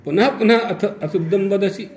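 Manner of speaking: high, loud voice calling out from the womb; high, loud voice from within. Retold or imitated. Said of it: "तो फिर आवाज आई".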